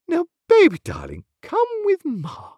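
put-on high, slowing baby talk; high-pitched doting mamma voice; cooing mother. A narrator's reading. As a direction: coax a baby sweetly, drawing out the end. Mother coos to her baby.

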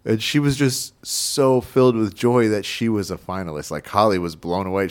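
normal talking voice

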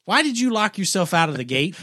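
high, fast, exasperated questions; incredulous scolding. Retold or imitated. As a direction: scold him like a bewildered wife. Wife scolding him at gate.

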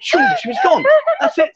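high-pitched, laughing delivery; laughing tease. Real, not acted. Host teasing the hostess about running for a drink, laughing. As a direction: laugh through the words.

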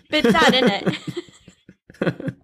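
laughing echo in mock British; put-on British accent. Echoing the co-host's accent line, laughing British accent. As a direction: mimic British while giggling. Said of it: "You say".

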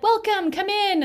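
high, loud sing-out greeting; cheery welcome. Retold or imitated. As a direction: imitate an eager receptionist greeting a patient. Acting out staff greeting patients.

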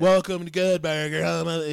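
loud put-on fast-food greeter voice; Kel. Shown as a demonstration. Comic retrying a burger cashier greeting, loud character voice. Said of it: "That sounds like Kel from Good Burger".

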